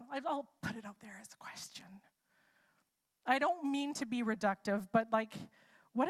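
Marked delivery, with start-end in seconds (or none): 0.1-1.8 real hushed aside, breathy and unvoiced; conspiratorial whisper. Real, not acted. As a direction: lean in and whisper it like a little secret.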